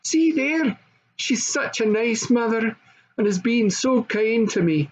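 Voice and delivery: put-on high, eager child's voice; little princess. A narrator's reading. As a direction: show off her friend eagerly.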